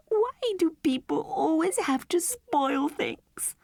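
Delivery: put-on high, slow, sobbing child's voice; a little girl crying over her toy. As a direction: sob out the complaint, slowly. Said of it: "sobbed Mandy".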